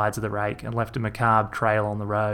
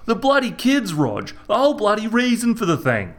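Reg's Aussie voice; 11 semitones higher, 4 dB louder than usual. A narrator's reading. Reg berating his brother about the kids. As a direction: scold him like a fed-up brother.

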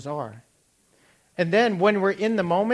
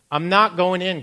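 louder defiant protest; defiant teen protest, louder; stubborn refusal; 3 semitones higher, 7 dB louder than usual. Retold or imitated. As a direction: dig in against the coach. Arguing with coach.

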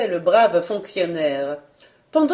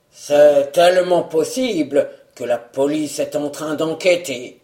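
cavernous voice; 4 semitones lower, 3 dB louder than usual. A narrator's reading. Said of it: "D'une voix caverneuse".

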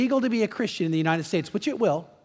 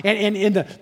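ordinary talk

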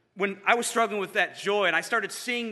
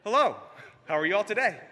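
higher, louder sing-out hello; cheery greeting; 4 semitones higher, 4 dB louder than usual. Retold or imitated. Late friend walking into small group, cheery imitated greeting. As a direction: breeze in with a high greeting.

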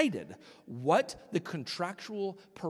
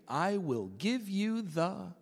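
sly serpent offer; 3 semitones higher, much slower than usual. Acted. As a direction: draw it out like a tempting secret. Serpent tempting Jesus.

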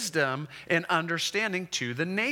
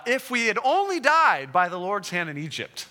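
higher, louder mock grumbling; whiny complaint; 6 semitones higher, 6 dB louder than usual. Israelites grumbling to Moses about dying in Egypt, in a loud whiny complaint. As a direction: voice a fed-up crowd, a bit over the top.

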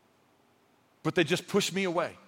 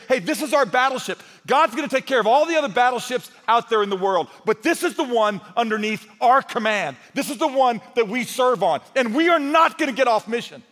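louder, higher rallying sermon voice; rally the congregation to the mission; preaching; 6 semitones higher, 6 dB louder than usual. Acted.

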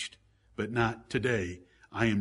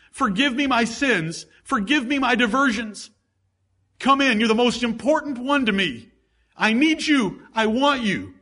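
loud, much higher impassioned plea to Jesus; pleading prayer; 11 semitones higher, 6 dB louder than usual. Acted. Preacher modelling a sinner's prayer, loud impassioned plea. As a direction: pray it out as a desperate penitent.

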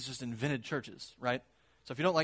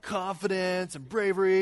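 normal talking voice

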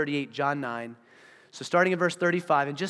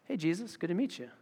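offhand greeting mock-casual; 6 dB quieter than usual. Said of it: "hands in his pocket".

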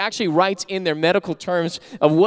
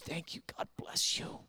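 whispered thank you; 18 dB quieter than usual. Retold or imitated. Beggar thanking the pastor, whispered. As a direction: breathe the thanks barely aloud. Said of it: "Guy says".

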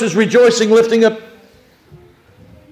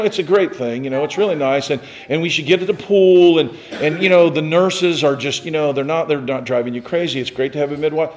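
ordinary delivery

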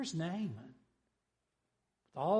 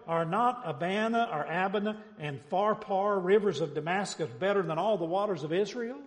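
loud, raised, boastful challenge; cocky Naaman; 5 semitones higher, 6 dB louder than usual. Acted. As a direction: proudly scoff at the lesser river, voice up. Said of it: "That sounds a little cocky too".

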